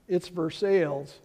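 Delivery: Versailles said ver-SALES, falling and sure; Kentucky pronunciation. Retold or imitated. Locals explaining Kentucky Versailles, said ver-SALES. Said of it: "They said".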